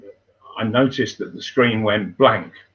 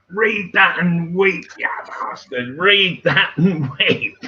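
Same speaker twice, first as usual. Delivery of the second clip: high, drawn-out yell across a distance; imitated shout, stretched words; retold scream; 8 semitones higher than usual. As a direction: re-enact screaming it triumphantly, pitched well up. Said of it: "and I screamed across".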